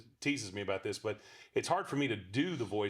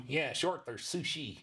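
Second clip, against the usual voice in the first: fish puppet voice; 3 semitones higher, slower than usual. Acted.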